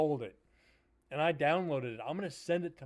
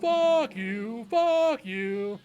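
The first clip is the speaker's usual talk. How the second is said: loud, high, drawn-out mock yell; hammed-up high-pitched defiant shouting; mock cursing outburst; 7 semitones higher, 8 dB louder, much slower than usual. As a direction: holler it three times, stretching each word. Mock outburst after own bad joke.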